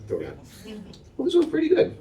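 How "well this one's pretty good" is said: much higher, delighted little verdict; pleased taster. Retold or imitated. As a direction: squeak with approval at the berry. Old woman tasting and sorting fruit.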